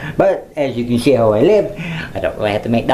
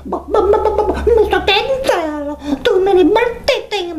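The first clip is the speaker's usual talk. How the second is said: mock whiny viewers. Vlogger imitating upset viewers complaining, high whiny squeak. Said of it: "They said".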